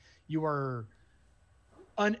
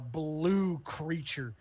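usual speaking voice

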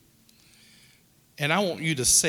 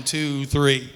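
ordinary delivery

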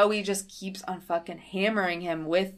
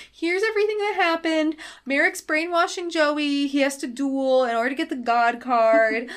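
put-on higher-pitched kid-sidekick recap voice; Mokuba; 7 semitones higher, 4 dB louder than usual. Mokuba telling Taya all that happened, high recap voice. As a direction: pitch it up, building as you lay out the news.

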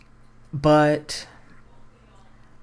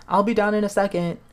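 calling from upstairs; 7 semitones higher than usual. Acted. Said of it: "yells Sarah's voice from upstairs".

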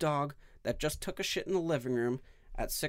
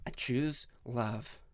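slow, low mock seductive line; mock sultry; 4 semitones lower, 3 dB quieter, much slower than usual. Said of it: "That sounded so, so sexual".

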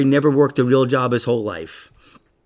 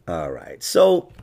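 ordinary delivery

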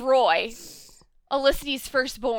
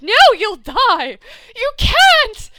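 alarmed protest; 8 semitones higher, 7 dB louder, slower than usual. Retold or imitated. Acts out Dinah's alarmed protest.

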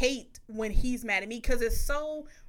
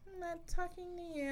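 higher, much softer sulking line; mock sulky; 7 semitones higher, 11 dB quieter than usual. Telling her partner she won't talk, mock sulk. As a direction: pout it out playfully. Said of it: "I'm just like".

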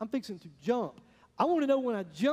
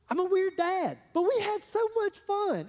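much higher, louder, drawn out; gleeful; 10 semitones higher, 5 dB louder, slower than usual. Real, not acted. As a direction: delight in the silly memory. Fond memory with daughter.

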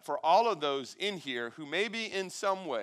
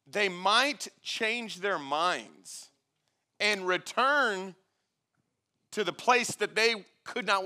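slow, raised, emphatic Bible reading; scripture reading; 5 semitones higher, 9 dB louder, slower than usual. Acted. Preacher reads Exodus to the congregation, slow emphatic scripture reading. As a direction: proclaim the passage, stretching the key words.

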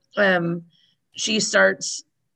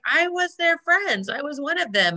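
high whiny voice; 6 semitones higher than usual. Retold or imitated. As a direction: whine it up high. Imitating Chandler's complaint.

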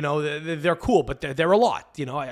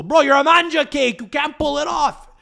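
high, loud mock indignation; Montreal attitude; 8 semitones higher, 4 dB louder than usual. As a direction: dismiss the pretender with a raised voice. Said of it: "That's what a lot of them will do".

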